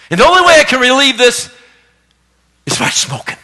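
lightly voiced flustered wife; wife's excuse. Wife caught smoking explaining her nerves to pastor, lightly voiced. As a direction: confess it sheepishly, drawing it out. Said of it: "She said".

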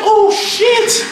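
high, hyped-up retold shriek; squealed astonishment, stretched out; energy suddenly changed. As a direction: explode upward in amazed excitement. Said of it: "my energy done changed".

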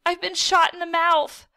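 high, loud cry of alarm; panicked shout. A narrator's reading. Boy tasting blood, shouting in panic. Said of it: "I shouted".

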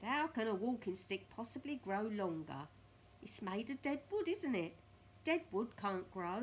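loud, emphatic scornful husband; Mr Twit scoffing. Acted. Mr Twit arguing that sticks cannot grow, loud scoffing. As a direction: lay it out loudly with scorn.

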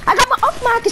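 high panicked cry; panicked yell. Real, not acted. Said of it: "zeg ik".

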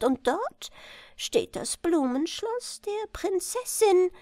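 a little chancellor's piping voice; high falsetto. Acted. Chancellor proposing marriage to the king, high falsetto.

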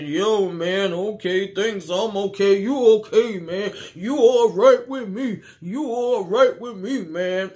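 loose, high and loud drunk-buddy impression; drunk man. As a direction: lurch through it like a friendly drunk. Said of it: "from one drunk to the other drunk".

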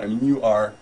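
ordinary talk, nothing marked

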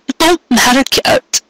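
put-on a distraught young mare, high; upset young mare. Cupcake's voice, upset.

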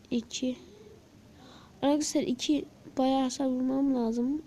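ordinary talk

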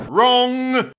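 smug Edward. Acted. Edward catches Gordon.

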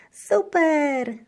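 high, loud cheer; high delighted shout; excited shout. A narrator's reading. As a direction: burst out with joy. Girl delighted by forest trip.

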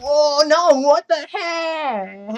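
loud, high drawn-out wail; wailing protest. Real, not acted. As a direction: wail it, stretching the last word. Failing the game.

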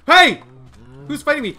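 sudden high startled yell; startled shout. Real, not acted. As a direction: yelp in alarm at the attacker. Mob attacks him suddenly.

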